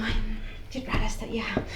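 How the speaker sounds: hushed aside to herself; whispered thought. Retold or imitated. Her whispering under her breath about the strange lady. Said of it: "Y yo así como".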